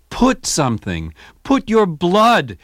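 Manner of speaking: loud impassioned cry. Retold or imitated. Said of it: "he cried at one rehearsal".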